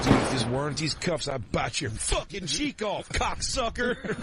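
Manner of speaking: put-on slow, sing-song Southern drunk threat; cocky drunk Southern, stretched out; lazy Southern drunk voice, dragging the words; drunk Southern drawl. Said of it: "And Southern".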